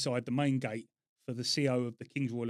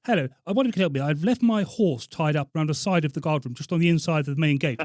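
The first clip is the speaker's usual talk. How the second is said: put-on colonel, loud and commanding; officer's prank-call voice, projected; Colonel Tarquin; 2 semitones higher, 7 dB louder than usual. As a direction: play the army colonel asking a favour. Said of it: "You done spot on the voice".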